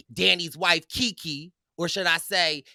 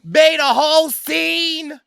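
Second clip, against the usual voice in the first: loud, high, hyped-up storytelling; worked-up shouting; 12 semitones higher, 10 dB louder than usual. Retold or imitated. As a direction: shout it out, reliving the commotion. Retelling own starstruck meltdown, shouting.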